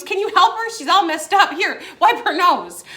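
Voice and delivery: loud, high-pitched retold plea; calling for help. Retold boyfriend hailing waiter for help.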